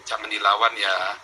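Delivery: slow, hushed fatherly reassurance; father's gentle advice. Retold or imitated. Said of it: "ayah saya selalu bilang apa?".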